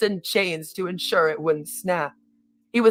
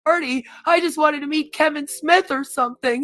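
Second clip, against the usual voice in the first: crying out; 8 semitones higher than usual. A narrator's reading. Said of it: "he cried".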